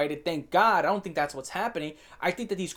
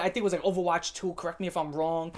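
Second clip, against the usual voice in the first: ordinary talk, nothing marked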